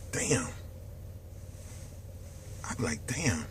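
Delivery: soft, low, stunned damn; stunned realisation. Retold or imitated. Imitating his own stunned realisation, soft and low. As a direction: let it drop in quiet shock.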